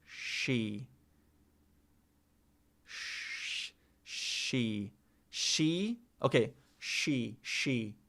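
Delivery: soft, low "she" drills; practicing she. Shown as a demonstration. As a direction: repeat "she" quietly, searching. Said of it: "You need specific tongue placement".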